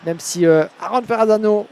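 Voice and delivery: usual speaking voice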